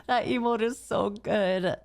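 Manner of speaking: amused words through real laughter; laughing. Real, not acted. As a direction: talk while still getting over a laugh. Still laughing at the emote.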